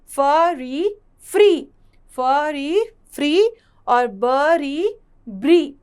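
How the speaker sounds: "ब", "री", then a punched, joined "ब्री"; syllable blending demo. Shown as a demonstration. Teacher moving to the next consonant for students, blending demo. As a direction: slowly sound out "फ री", then blend into "फ्री". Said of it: "अगला व्यंजन है हमारा".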